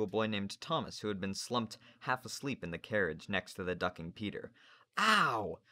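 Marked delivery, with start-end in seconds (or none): 5.0-5.6 sharp, high "ow"; high cry of pain; pained yelp. A narrator's reading. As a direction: yelp loudly as if cuffed. Boy cuffed on the ear.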